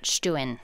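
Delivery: Stuen said slowly as "STOO-en", two syllables, oo vowel; Stuen, the Low German way, "STOO-en"; family pronunciation. Shown as a demonstration. Guest teaching the host her family's way: Stuen as "STOO-en". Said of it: "my family pronounces it".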